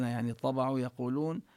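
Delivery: usual speaking voice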